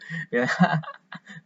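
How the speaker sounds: a light, put-on giggle; laughing. Shown as a demonstration. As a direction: demonstrate catching a kid's laugh. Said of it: "I'm gonna laugh too".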